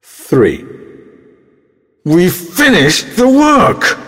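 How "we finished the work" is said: emotional. Shown as a demonstration. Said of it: "said in nine different ways".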